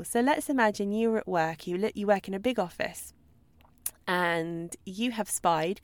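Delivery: normal talking voice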